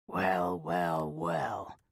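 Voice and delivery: put-on low, slow raspy old-man drawl. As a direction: drawl it out like a worn tough guy. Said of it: "he drawled in a raspy voice".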